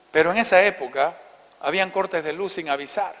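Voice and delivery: ordinary delivery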